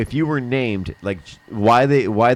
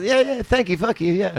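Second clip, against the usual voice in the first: put-on English accent; 7 semitones higher than usual. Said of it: "just be an English".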